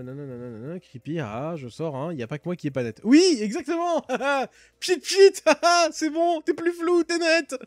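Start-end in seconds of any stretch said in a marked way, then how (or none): 3.0-7.6 high, loud and laughing through it; squealing with laughter at own joke; giddy laughing joke. Acted. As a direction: crack yourself up over the pun. Joking about being blurry, laughing.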